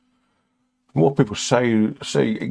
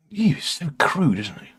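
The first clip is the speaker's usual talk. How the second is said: mock disgusted viewer; 6 semitones higher than usual.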